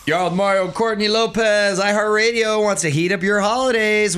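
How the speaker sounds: high, stretched-out announcer pitch; radio announcer intro. Acted. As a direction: launch the contest big and high. Opens contest announcement.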